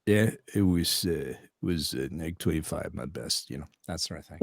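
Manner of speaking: soft, low, hesitant actor impression; subdued, mumbled screen-actor voice; Sean Penn. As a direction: let it trail away, hushed and halting. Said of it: "I didn't know you did, uh, Sean Penn".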